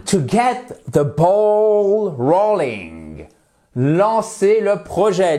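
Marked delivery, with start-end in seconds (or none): 0.0-3.3 slow, careful English model; slowly spelled-out idiom; slow model phrase. Shown as a demonstration. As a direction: say it slowly for the class. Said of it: "next one".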